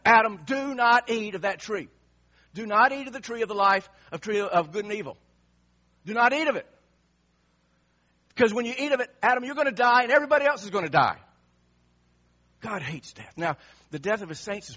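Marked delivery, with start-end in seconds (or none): 0.0-11.2 loud, higher-pitched urgent command; stern warning. Acted. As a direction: thunder a forbidding warning at full force. Preacher voices God's warning to Adam.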